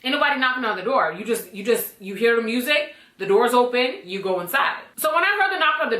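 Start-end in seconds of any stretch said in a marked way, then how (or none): none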